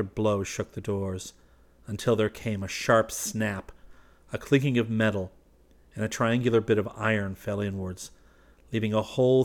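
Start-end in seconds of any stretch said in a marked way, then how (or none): none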